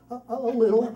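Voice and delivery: put-on high, loud stammer of a frightened kid; imitated scared boy. Boy admits to dad he is scared, imitated fright. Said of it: "Phil, are you scared?".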